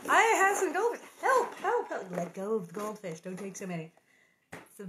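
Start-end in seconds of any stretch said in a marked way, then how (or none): 0.1-2.1 much higher, louder squeaky cry; mock trapped monkey. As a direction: play the greedy trapped monkey. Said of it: "You go".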